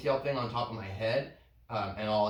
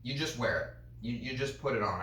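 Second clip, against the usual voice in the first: ordinary talk, nothing marked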